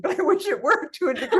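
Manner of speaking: wry confession dissolving into laughter; laughing admission. Real, not acted. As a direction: laugh through the words. Joking about not getting rich.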